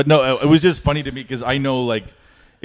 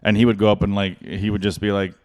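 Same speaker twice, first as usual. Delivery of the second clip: ordinary talk, nothing marked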